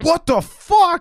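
high, slow, shocked outburst. Retold or imitated. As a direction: gasp it out, stunned. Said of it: "they'd be like".

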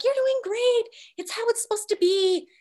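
mock husband voice. Mocking her husband's reassurance in the OR, high mock voice. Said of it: "My husband's just like".

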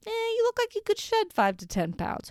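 mock pushy seller. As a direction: ham up a fake-friendly MLM recruiter. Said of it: "and just be like".

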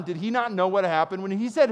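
usual speaking voice

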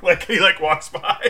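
ordinary talk

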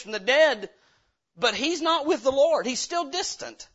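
loud, high, driving preacher's cry; preaching at full force. Acted. Pastor crying out to the congregation, loud and high.

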